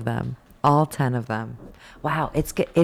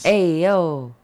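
a louder "Hey, yo" from a street-tough Italian guy; 5 dB louder than usual. Acted. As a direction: call out like a guy in a parking lot. Voicing Italian man in joke.

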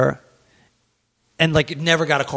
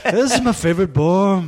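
Liverpool accent; 5 semitones higher than usual. Shown as a demonstration. Showing how the British attempt turns out, mock Liverpool accent. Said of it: "that just sounds like the Beatles".

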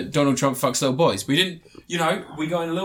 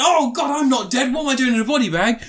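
high, loud mock panic; hammed-up startled hero; panicked Tom Cruise; 8 semitones higher, 4 dB louder than usual. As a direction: shout like you woke up in a body bag. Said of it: "He's like".

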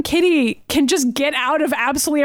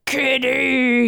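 imitating Logan. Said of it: "And he's like".

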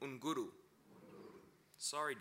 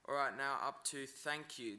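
normal talking voice

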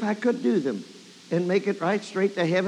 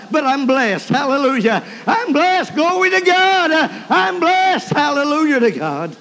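emotional praise; 6 semitones higher, 5 dB louder than usual. Real, not acted. Preacher giving up on acting dignified, emotional praise. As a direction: cry out your blessing with mounting fervor. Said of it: "get so emotional".

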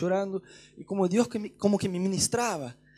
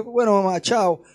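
louder, quick "bueno, mamá, chao"; hurried goodbye; 2 semitones higher, 9 dB louder than usual. Retold or imitated. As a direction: cut the call short, louder. Hurrying off the phone.

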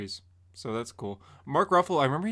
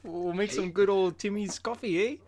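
put-on Canadian accent; 5 semitones higher, 3 dB quieter than usual. Mock Canadian friend offering Timbits.

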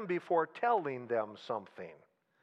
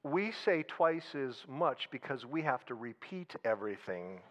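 low, soft and unhurried; a deadpan, calm comeback; calm reply; 7 semitones lower, 5 dB quieter than usual. Retold or imitated. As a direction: deliver the punchline calmly and coolly. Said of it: "calmly looks at him and says".

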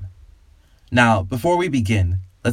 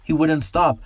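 higher, more panicked repeat; second take, pitched higher and more desperate; more frightened; 7 semitones higher than usual. A narrator's reading. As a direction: say it again, rising toward panic. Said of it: "shaking in fear".

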